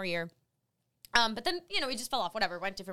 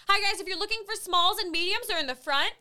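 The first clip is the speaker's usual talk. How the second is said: higher-pitched retail helper announcement; calling to crowd; 6 semitones higher than usual. Retold or imitated. Volunteer redirecting shoppers to shirt sizes, calling out. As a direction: direct the shoppers cheerily.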